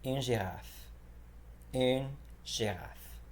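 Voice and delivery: Une girafe slowed right down, soft French g; slow French demo. Shown as a demonstration. Teacher slowing Une girafe for learners, careful French. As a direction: spell out Une girafe slowly for beginners. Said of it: "you would just say une girafe".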